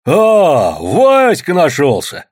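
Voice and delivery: loud, high, drawn-out welcome; delighted. A narrator's reading. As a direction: greet him with a big glad "ah". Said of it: "обрадовался дядя Фёдор".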